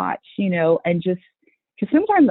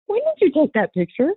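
put-on surprised kid; 8 semitones higher, faster than usual. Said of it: "they'll look over at me like".

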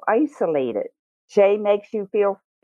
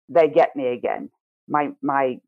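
ordinary delivery

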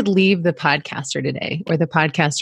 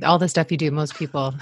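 usual speaking voice

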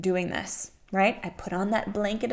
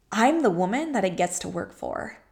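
normal talking voice

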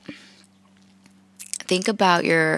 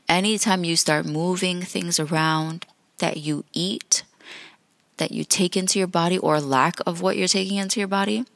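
ordinary delivery

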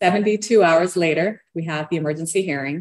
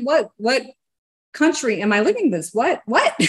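high-pitched, bewildered outburst; incredulous; 8 semitones higher, 3 dB louder than usual. Retold or imitated. Client reeling at the lawyer's warning, incredulous. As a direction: question it all in a high, disbelieving voice. Retelling her disbelief, then laughs.

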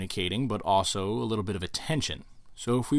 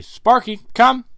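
much higher and louder dog call; firm recall command; 12 semitones higher, 11 dB louder, slower than usual. Shown as a demonstration.

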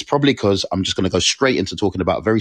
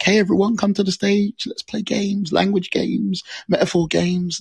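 high, sing-song invitation; high-pitched hammed-up announcer call; mock game host; 13 semitones higher than usual. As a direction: call everyone in like a children's games host. Mimics own former host calls.

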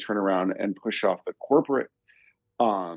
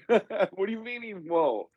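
laughing disbelief; 10 semitones higher, slower than usual. Retold or imitated. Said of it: "I'm like".

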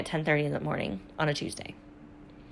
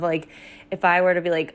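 ordinary talk, nothing marked

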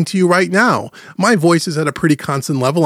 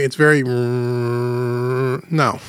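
low, drawn-out drone on one note; exaggerated demo of droning monotone; 4 semitones lower, much slower than usual. Shown as a demonstration. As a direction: trail the last word into a long, level hum. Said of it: "No one speaks like that".

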